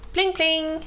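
high ringing bell imitation; bell pling. Narrator imitating the cow's bicycle bell, high and ringing. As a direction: chime it out like a little bell.

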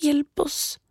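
put-on high, pleading girl's voice; pleading prayer. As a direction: pray desperately as a little girl. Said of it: "ba hun".

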